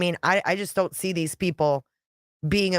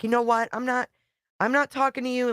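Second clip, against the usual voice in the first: higher, mock-indignant wife voice; imitating the wife; 7 semitones higher than usual. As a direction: play her brushing him off, pitched up. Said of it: "she's like".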